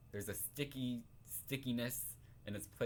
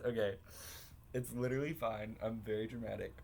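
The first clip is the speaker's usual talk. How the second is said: ordinary talk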